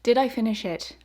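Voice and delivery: ordinary delivery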